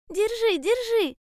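very high, insistent cries; urgent hedgehog. A narrator's reading. Hedgehog urging bear cub to hold the line, urgent cry. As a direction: urge him on in a high hedgehog voice.

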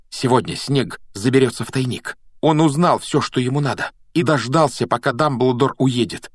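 put-on high, emphatic boy's voice; urgent Harry. A narrator's reading. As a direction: lay out the plot in agitation. Voicing Harry's urgent speech.

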